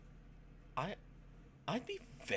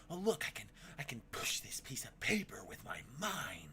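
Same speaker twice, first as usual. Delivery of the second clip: hint of a kid pretending. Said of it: "I always used to do the".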